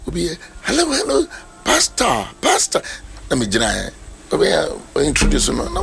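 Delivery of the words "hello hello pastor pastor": excited lady. Retold or imitated. Lady greets the pastor excitedly.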